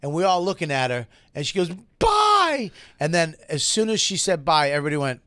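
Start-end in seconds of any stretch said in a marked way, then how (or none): 2.0-2.7 very high, drawn-out mock wail; hammed-up high-pitched farewell; tearful parting shout. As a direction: imitate an upset woman's parting cry. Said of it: "she turned back in tears".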